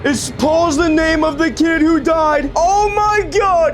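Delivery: high cry rising to a wail; slow, high-pitched shock; horrified realisation. Real, not acted. Ghost hunter realising Paul is the kid who died, horrified realisation. Realises it is dead child's name.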